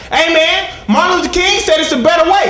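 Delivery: high, shouted upbeat appeal; earnest positivity. Acted. Customer reasoning with bank robbers, high positive shout. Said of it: "I tried to hit them with the positivity".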